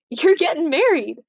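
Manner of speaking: a touch of a bright mother's announcement; lightly voiced mom breaking news; mother cheerfully springing a wedding on her daughter; announcing mother. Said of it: "Lady Capulet announces".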